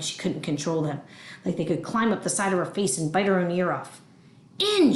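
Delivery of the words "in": high, sharp one-word shout; shouted order. Acted. Mad grandma ordering the child into the fridge, shouting. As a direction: shout it as a furious command. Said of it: "she shouted".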